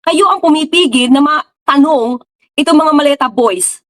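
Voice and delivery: ordinary talk, nothing marked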